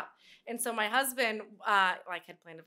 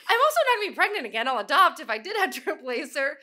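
higher, louder, quick laughing rebuttal; laughing retort; 8 semitones higher, 9 dB louder than usual. Retold or imitated. Telling mom she won't replace her daughter, laughing retort. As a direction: fire it back while laughing.

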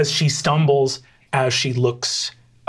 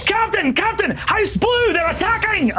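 high panicked crew warning; alarmed shouting. Acted. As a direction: cry the alarm to the captain, pitched way up. Cheese women run up warning.

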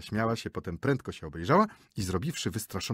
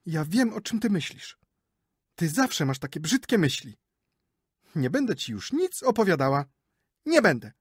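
higher, rising to louder huffy refusal; sulking wife; 8 semitones higher, 6 dB louder than usual. Acted. Wife sulks at her suspicious husband, higher and pouting. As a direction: pout and refuse to tell more.

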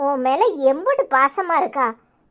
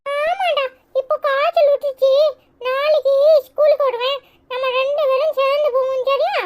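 put-on high-pitched little kid brother voice; little brother, eager; 12 semitones higher than usual. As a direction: chatter up high like a small boy telling his big brother. (உச்ச குரலில் பேசும் குட்டித் தம்பி)

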